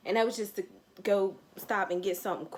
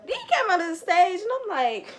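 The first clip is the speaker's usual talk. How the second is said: higher and louder gush; excited; 9 semitones higher, 5 dB louder than usual. Real, not acted. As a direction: build up the reveal. Celebrity walks on stage.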